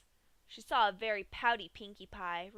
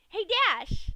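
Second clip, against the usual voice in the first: much higher, much louder cheery call; piping up; 11 semitones higher, 14 dB louder than usual. A narrator's reading. Pinkie calling to Dash before yanking, high and loud. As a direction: pipe up like a game. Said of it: "Pinkie piped up".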